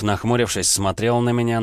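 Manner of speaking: ordinary delivery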